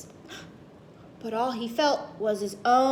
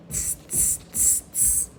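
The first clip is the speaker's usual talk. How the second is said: soft unvoiced tss hisses; monkey tsk sounds; 7 dB quieter than usual. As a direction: tut back like a cheeky monkey. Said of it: "the monkeys ... said".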